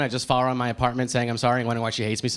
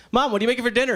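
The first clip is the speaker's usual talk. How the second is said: calling mom; 10 semitones higher, faster than usual. Retold or imitated. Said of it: "and I'm like".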